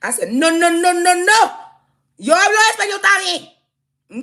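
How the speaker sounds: high piercing mock voice, drawn out; mocking high shriek. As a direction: shriek it back at him in mock outrage. Mocking him, switching to Spanish.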